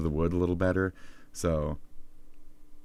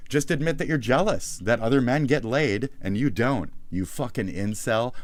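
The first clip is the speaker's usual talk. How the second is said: ordinary delivery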